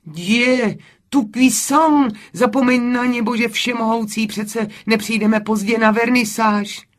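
high, loud, impatient exclamation; exasperated outburst. A narrator's reading. Camille scolds her friend dawdling over gloves, loudly exasperated.

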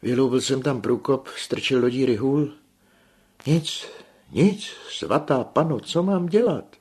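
high, loud and out of breath; breathless panic. A narrator's reading. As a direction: gasp it out in a frantic panic. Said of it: "udýchaně pokračoval". (udýchaná panika)

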